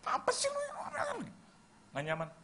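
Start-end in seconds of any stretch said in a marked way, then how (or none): 0.0-1.1 very high squeaky scolding; hammed-up spousal nagging in a thin squeal; mock nagging. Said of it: "istrinya udah diomelin".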